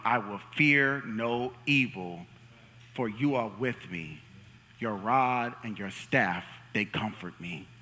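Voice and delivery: slow, hushed reading of the psalm; solemn scripture reading. Acted. Pastor reading the psalm to the congregation, slow and solemn. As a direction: read it slow and reverent, fading at the end. Reads scripture aloud slowly.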